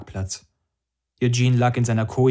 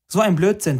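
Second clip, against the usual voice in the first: higher, mildly indignant retort; indignant hiss; 7 semitones higher, 4 dB louder than usual. A narrator's reading. Anna rejecting the promoter's excuse, mildly indignant. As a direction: dismiss it with a touch of anger. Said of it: "zischte Anna".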